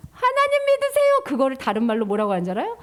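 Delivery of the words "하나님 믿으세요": 높고 크게 외치는 소리; 세계 사람들에게 외치듯; calling out. Shown as a demonstration. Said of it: "뭐라고 외칠까요". (높고 크게 외치기)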